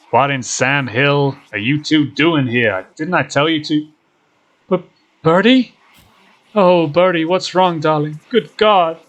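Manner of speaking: high, loud, alarmed outcry; loud, distressed character voice; distraught Duke. Acted. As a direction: cry out in shock over a beloved hurt animal. Acting Duke finding injured Birdie.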